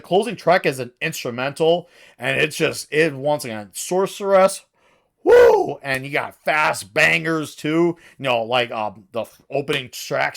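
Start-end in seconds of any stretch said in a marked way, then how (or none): 5.2-5.8 a high, loud, thrilled whoop; excited whoop. Real, not acted. Reviewer cheering the closing instrumental: a loud whoop. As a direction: let out a delighted cheer.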